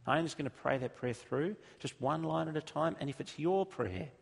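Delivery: fervent pastor lead-in to prayer; preaching. Real, not acted. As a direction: preach it out to the room with conviction. Preaching at full force.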